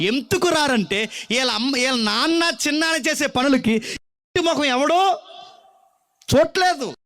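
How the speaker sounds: put-on preacher pressing the congregation on a lonely child; full-force preaching. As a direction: preach it out at the top of the voice.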